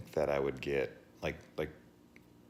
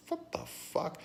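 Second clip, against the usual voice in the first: much higher, stunned outburst; shocked disbelief. Real, not acted. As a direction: jump up in pitch in pure disbelief. Reacting in disbelief.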